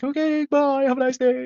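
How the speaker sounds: very high, sing-song goodbye; fake smiling politeness. Driver trying to get away, falsely cheery farewell. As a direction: chirp it through a nervous smile. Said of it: "smiling like".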